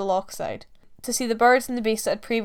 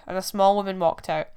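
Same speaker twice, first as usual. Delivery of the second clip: ordinary delivery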